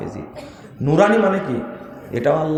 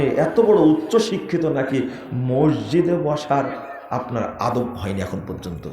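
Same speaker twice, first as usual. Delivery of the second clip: ordinary talk, nothing marked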